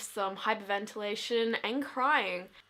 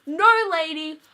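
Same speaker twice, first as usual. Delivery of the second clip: loud mock snap of refusal; rude refusal; 5 semitones higher, 9 dB louder than usual. Girl refusing the teacher who says go first, loud. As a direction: shout back a blunt no at her. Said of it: "Okay, I wasn't that rude".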